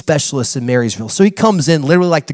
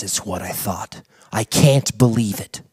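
low, hushed and slow; a mysterious low murmur; 9 semitones lower, 6 dB quieter than usual. Retold or imitated. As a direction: lean in like you've found a dark secret. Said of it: "he's all mysterious".

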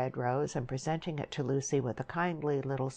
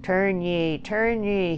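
loud, solemn intoning; intoned; 5 semitones higher, 6 dB louder, slower than usual. A narrator's reading. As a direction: intone it like a preacher's warning. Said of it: "he intoned".

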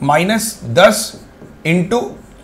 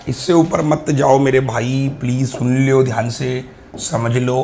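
slow, lowered, drawn-out coaxing; low-key imploring, sinking softer towards the end; slow plea; 4 semitones lower, 4 dB quieter than usual. Real, not acted. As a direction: plead patiently, stretching the words and easing off. Pleading with class after loud warning.

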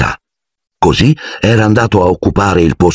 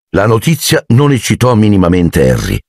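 usual speaking voice

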